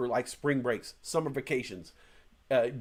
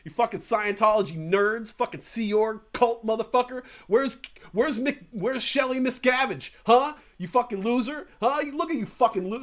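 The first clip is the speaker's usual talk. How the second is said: imitating a guy loudly clowning on cult members; jeering, taunting street heckle, a bit louder; retold mocking tirade at the Scientologists; mock heckling rant; 6 semitones higher, 4 dB louder than usual. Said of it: "and just, like, going off".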